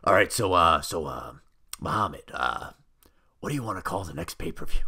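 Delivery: normal talking voice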